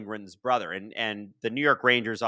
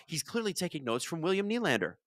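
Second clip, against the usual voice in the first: normal talking voice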